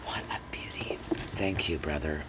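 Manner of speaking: a gasp, then whispered in awe; whispered awe over a beautiful book; awed whisper. Real, not acted. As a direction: breathe it out in wonder, voiceless.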